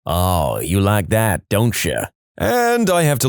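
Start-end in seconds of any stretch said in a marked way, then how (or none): 0.0-2.0 low, moaning come-on, lightly acted; sultry moan. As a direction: purr it like a cheesy flirt. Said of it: "moans, and says".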